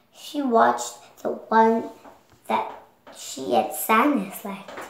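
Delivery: plain reading voice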